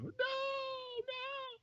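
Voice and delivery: very high, thin mock scream; imitated distant screams. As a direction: scream from far off without volume. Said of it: "the sounds of screams".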